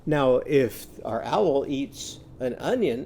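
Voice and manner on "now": usual speaking voice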